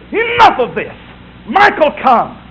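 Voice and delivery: high, loud summons; commanding shout. Acted. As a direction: call down the army with authority. Imagined Jesus summoning the archangel.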